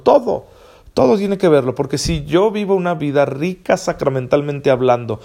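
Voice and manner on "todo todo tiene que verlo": "Todo" rotundo, agudo y fuerte; respuesta enfática. Real, not acted. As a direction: answer the objection with a high, emphatic "Todo". Responde tajante a objeción imaginada. (respuesta rotunda y enfática)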